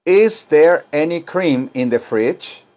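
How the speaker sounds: very slow, rising question; slow model question. Shown as a demonstration. Teacher models a slow question for class. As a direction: ask it slowly for students.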